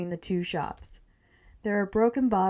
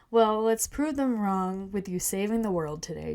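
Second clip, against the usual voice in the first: ordinary delivery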